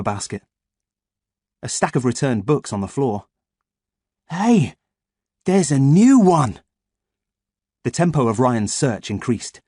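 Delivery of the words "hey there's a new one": high, loud delighted shout; excited. A narrator's reading. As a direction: call out the find gleefully. Boy finds new card.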